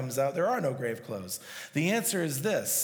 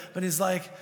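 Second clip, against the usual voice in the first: usual speaking voice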